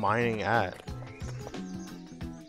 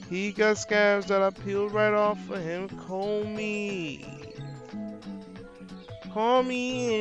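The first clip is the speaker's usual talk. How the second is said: higher, slow mock sweet voice; high character voice; 7 semitones higher than usual. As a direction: play a sugary character lightly.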